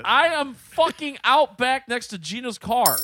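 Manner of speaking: exasperated. Retold or imitated. Arguing on the phone about where he is, exasperated. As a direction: retell it like fed-up yelling.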